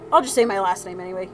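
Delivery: usual speaking voice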